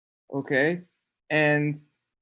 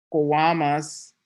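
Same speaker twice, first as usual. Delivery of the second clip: ordinary talk, nothing marked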